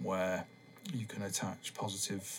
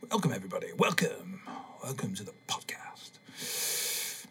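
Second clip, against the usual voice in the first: mock introduction. Host welcomes podcast listeners in a mock soothing whisper. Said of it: "You're supposed to introduce".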